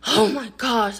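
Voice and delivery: loud, exasperated oh my gosh; annoyed mom. Retold or imitated. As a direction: burst out, fed up. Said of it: "And my mom was like".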